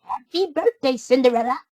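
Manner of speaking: high squeaky little mouse voices; squeaky mice. A narrator's reading. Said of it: "squeaked the mice".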